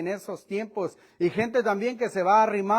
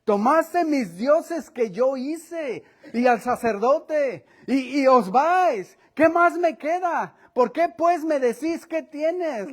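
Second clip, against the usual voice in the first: slow, higher, louder broken cries; desperate outburst; 6 semitones higher, 4 dB louder, slower than usual. Acted. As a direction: blurt it out in frustrated desperation. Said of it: "responde en frases apresuradas y cortadas". (arrebato desesperado y entrecortado)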